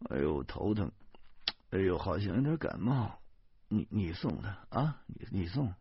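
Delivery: soft, feeble moaning excuse; groaning.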